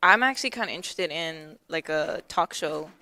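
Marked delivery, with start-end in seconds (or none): none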